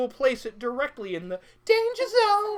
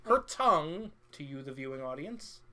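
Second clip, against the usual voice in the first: showman announcer; 5 semitones lower than usual. Acted. Sideshow host address.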